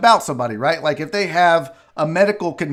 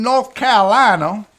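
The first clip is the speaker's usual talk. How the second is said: put-on Southern accent; 3 dB louder than usual. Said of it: "I don't know why I went Southern accent on that".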